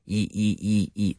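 short repeated "E" as a pure vowel; quick, separate "E" sounds, low-pitched; isolated EE sound. Shown as a demonstration. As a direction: drill the vowel "E" in short bursts. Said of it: "that sound".

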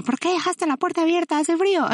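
high-pitched mock scolding; retold scolding. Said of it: "yo así regañándolo de".

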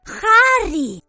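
usual speaking voice

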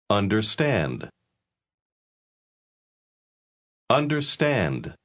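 "Understand" built up in pieces with long pauses; syllable by syllable. Shown as a demonstration. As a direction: model "Stand", "Der", "Un", then join "Understand". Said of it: "Repita sílaba por sílaba". (sílaba por sílaba, con pausas)

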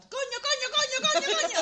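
coño shouted again and again, high and loud with laughter; shouting coño. Shown as a demonstration. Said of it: "empezamos a gritar".

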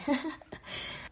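short breathy laugh after choking. Real, not acted. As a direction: laugh it off, half coughing. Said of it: "Ay, me ahogué".

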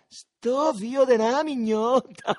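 long, drawn-out high shout; grido. A narrator's reading. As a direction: yell the insult slowly at full voice. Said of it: "gridò Lenzetta". (gridato forte, acuto e strascicato)

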